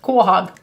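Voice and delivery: Quahog said as KWO-hog, two syllables, hard g at the end; local pronunciation. Shown as a demonstration. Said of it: "We know how to say it".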